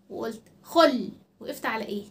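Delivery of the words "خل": "خل" punched louder, resting on the ل; stop on final consonant. Shown as a demonstration. Teacher showing children a sukun, stressed stop on last letter. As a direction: hit "خل" hard and stop on its ل. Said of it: "وقفت على الدال وعلى اللام".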